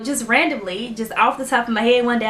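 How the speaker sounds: ordinary delivery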